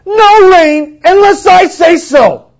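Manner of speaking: loud, high, slow shouted decree; defiant shout. Acted. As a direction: hurl it at the king. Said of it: "slap in the face".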